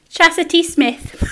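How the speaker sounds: the name said slow in broad Geordie, with a laugh; Geordie accent. Shown as a demonstration. Reading a viewer's name in Geordie as she asked. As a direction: say it in thick Geordie. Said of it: "wanted to hear me say her name in my accent".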